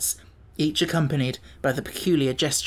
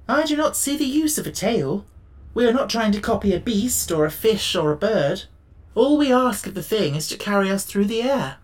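lightly voiced Woodman, higher pitched; a hint of character, pitched up in objection; objecting Tin Woodman; 6 semitones higher than usual. A narrator's reading. Tin Woodman disputing the need for a tail, mildly objecting.

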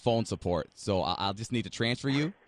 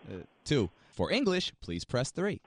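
phone menu voice; 4 semitones higher, 7 dB louder, slower than usual. Acted.

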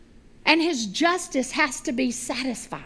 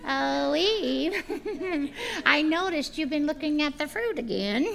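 sly chuckling tempter, drawn out; tempting serpent. Acted. Serpent greeting Eve by the fruit tree, sly tempter.

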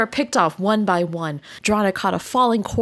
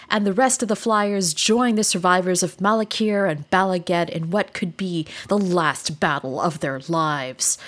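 normal talking voice

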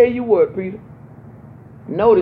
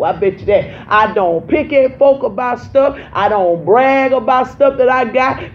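raised, fervent preacher climbing higher and louder; preaching at full force; 6 semitones higher, 5 dB louder than usual. Acted. Pastor preaching humility to her congregation, full force. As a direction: drive it home to the church, loud and high.

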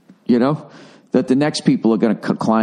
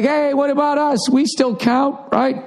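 much higher, whiny little guy; squeaky panicky sidekick; 12 semitones higher, slower than usual. Retold or imitated. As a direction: squeak it like the overlooked kid. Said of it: "Korea's like".

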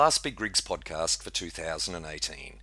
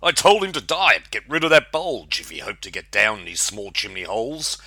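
high, loud indignant complaint easing off; disgusted grumble; 3 semitones higher, 9 dB louder than usual. A narrator's reading. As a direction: complain loudly, then settle. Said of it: "he said with disgust".

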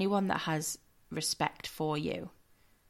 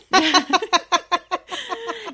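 loud high burst of laughter; big high laugh; 12 semitones higher, 9 dB louder than usual. Real, not acted. Bursts out laughing mid-sentence.

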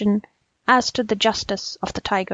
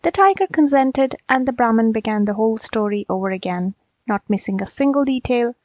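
plain reading voice

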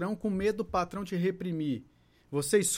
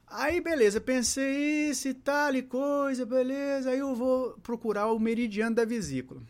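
singsong musing; 7 semitones higher than usual. Retold or imitated. Retelling own thoughts in singsong.

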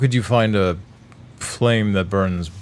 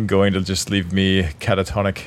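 usual speaking voice

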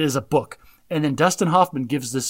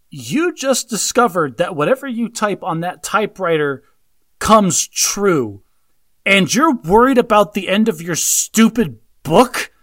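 rising exasperated disbelief, louder and higher; exasperated rant; 5 semitones higher, 6 dB louder, slower than usual. Real, not acted. Podcaster ranting at the movie character, exasperated.

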